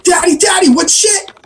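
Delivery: put-on very high, squeaky child impersonation; little boy voice. Little Johnny asking shaving dad about a swear, high child voice. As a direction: pipe up like an innocent kid.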